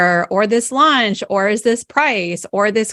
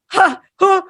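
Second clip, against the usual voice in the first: high, breathy stunned huhs; 10 semitones higher, 4 dB louder than usual. Retold or imitated. Reacting to a guide's sudden dope slap, stunned high huhs. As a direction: gasp out little bewildered huhs, pitched way up. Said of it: "And I was like".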